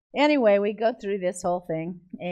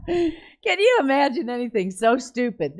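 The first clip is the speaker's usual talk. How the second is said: loud, higher, just out of a laugh; amused disbelief; 5 semitones higher, 7 dB louder than usual. Real, not acted. Preacher mocking her own blunder to the crowd, amused disbelief. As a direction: exclaim it through the tail of real laughter.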